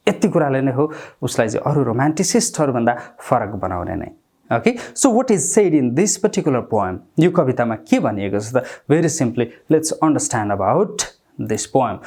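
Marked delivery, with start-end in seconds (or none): none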